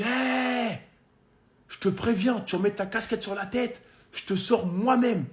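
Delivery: voix de videur imitée, bien plus aiguë; videur menaçant. Retold or imitated. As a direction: singer un videur qui menace, voix montée. Videur imité qui menace. (caricature aiguë d'un videur agressif)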